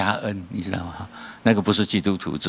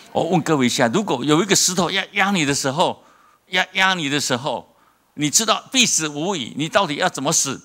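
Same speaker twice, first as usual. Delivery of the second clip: preach; 8 semitones higher than usual. Acted. Preacher poses question, raised pitch.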